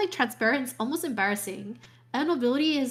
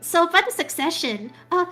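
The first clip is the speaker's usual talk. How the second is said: fawning noble; 6 semitones higher, 4 dB louder, faster than usual. Retold or imitated. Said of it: "and the nobility like".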